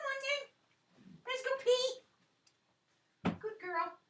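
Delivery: high, bright calling to the dogs. Real, not acted. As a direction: call the dogs cheerfully to come out.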